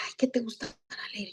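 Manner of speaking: put-on soft whisper to a sleeping child. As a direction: whisper it gently at the bedside. Talking to boy in coma. (susurrando al niño en coma)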